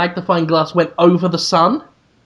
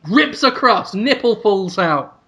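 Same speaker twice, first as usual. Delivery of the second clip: normal talking voice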